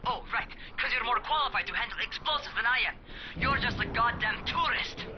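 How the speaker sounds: sarcastic retort. Acted. Rahim mocks Crane's claim to the job, sarcastic. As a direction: throw his words back at him.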